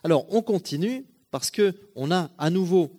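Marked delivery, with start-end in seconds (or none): none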